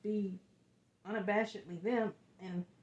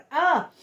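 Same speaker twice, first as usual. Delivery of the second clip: emotional laugh; 8 semitones higher, 11 dB louder than usual. Real, not acted. Emotional laugh during reading.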